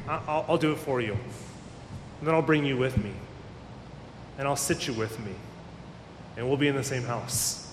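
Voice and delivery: Jesus reassuring Peter, soft and tender; tender reassurance. Acted. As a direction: hush it down like comforting someone close.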